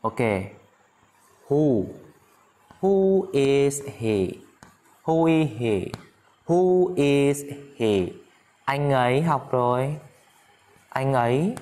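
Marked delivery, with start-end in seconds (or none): 1.5-4.3 very slow, each word held; slow model question. Shown as a demonstration. Teacher introduces the question to students, very slowly. 6.5-8.1 drawn-out words, very slow; slow model question. Shown as a demonstration. Teacher drills students on the question, very slowly.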